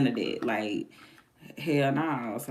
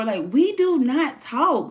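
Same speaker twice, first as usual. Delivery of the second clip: slow, higher, louder with every word hit; emphatic; 11 semitones higher, 4 dB louder, much slower than usual. Real, not acted. Telling chat she and the guy never talk, each word stressed. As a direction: spell it out word by word. Insisting to chat on her point.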